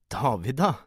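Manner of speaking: high, loud exclamation; exclaiming. A narrator's reading. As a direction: burst out in startled reproach. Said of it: "utbrøt hun". (høyt, overrasket utbrudd)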